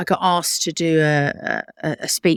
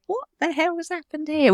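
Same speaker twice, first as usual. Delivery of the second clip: disbelief; 11 semitones higher than usual. Retold or imitated. Retold disbelief with colleague.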